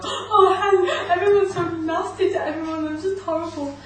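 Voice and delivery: high, loud, hammed-up wail with laughter; mock wailing. Mocking her own oversensitive moments to an interviewer, mock wailing. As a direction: burst into an over-the-top lament.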